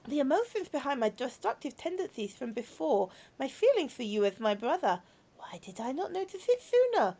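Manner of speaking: soft, earnest confession; quiet robot girl baring her feelings; tender robot girl. Acted. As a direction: speak gently from a troubled heart. Voices a robot girl in game.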